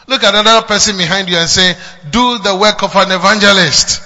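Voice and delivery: booming call to the congregation; loud preaching. Acted.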